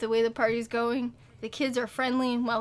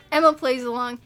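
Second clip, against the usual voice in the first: ordinary delivery, nothing marked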